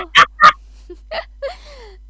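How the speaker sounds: loud high cackling laugh; burst of laughter. Real, not acted. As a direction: crack up out loud. Bursts out laughing mid-line.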